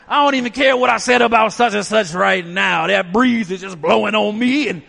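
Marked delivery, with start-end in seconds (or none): none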